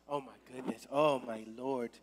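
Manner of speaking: low, soft pretend dismay; mock horrified murmur. Preacher mimics vegans hearing of sacrifice, hushed mock dismay. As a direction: imitate appalled listeners under your breath.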